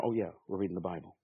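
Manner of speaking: quick sheepish self-correction; catching yourself. Retold or imitated. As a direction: mutter it quickly like you just remembered. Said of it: "your mind starts to go".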